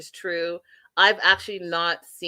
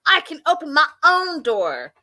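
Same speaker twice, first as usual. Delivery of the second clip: indignant, higher and louder; mock outrage; 8 semitones higher, 4 dB louder than usual. Wife complaining about the opened door, mock indignation. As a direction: snap it back like she's offended. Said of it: "She's like, freaks out".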